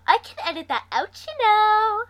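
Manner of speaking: teasing sing-song, rising high on you know. A narrator's reading. Sister teasing her brother, sing-song. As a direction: lilt it up high like a playground taunt. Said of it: "I say in a sing-song voice".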